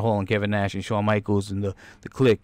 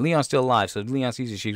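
ordinary talk, nothing marked

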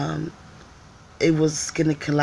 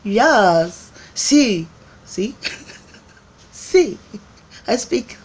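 eager yes; 5 semitones higher, 5 dB louder, much slower than usual. Retold or imitated. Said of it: "And I was like".

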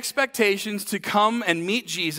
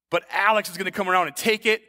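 normal talking voice

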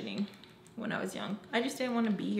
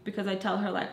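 usual speaking voice

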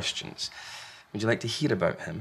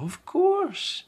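higher, warm sing-song reply; Large, loving parent; 4 dB louder than usual. Acted. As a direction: answer as if it's obvious. Large answers Small.

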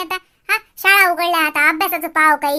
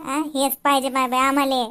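normal talking voice

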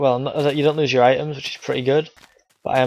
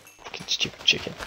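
whispered and soft under the breath; whispered curse; 10 dB quieter than usual. Real, not acted. Gamer cursing a chicken, whispered.

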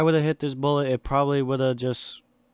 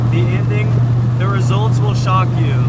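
loud, higher hyped teaser voice; clickbait video narrator; 5 semitones higher, 7 dB louder than usual. Acted. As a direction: punch the hook like a clickbait trailer. Hyped video intro over music.

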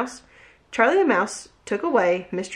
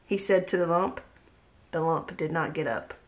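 usual speaking voice

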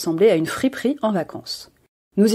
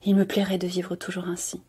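soft dreamy murmur; 8 dB quieter than usual. A narrator's reading. Young woman murmurs to the sky, softly. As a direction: murmur it, lost in the clouds. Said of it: "J'entends Donia Sol murmurer".

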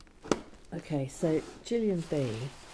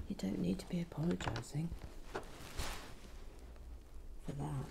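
soft unvoiced whisper; whispered reassurance; 10 dB quieter, faster than usual. Real, not acted. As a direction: whisper it kindly, almost secret. Gentle reassurance to commenter.

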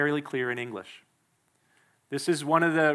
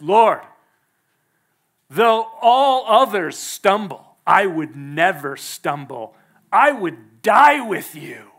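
bold vow; 2 semitones higher, 8 dB louder, slower than usual. Acted. Peter boldly proclaims devotion.

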